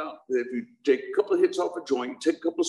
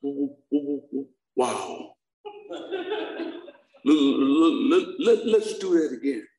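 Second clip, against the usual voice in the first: stuttering Steve; slower than usual. Retold or imitated. Steve, dazed after his seizure, stuttering to his friends. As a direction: stammer out the wow, then push the lets. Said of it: "Stuttering Steve".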